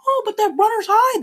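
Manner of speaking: high falsetto mockery of a runner; mocking runner. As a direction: parody a smug runner in falsetto.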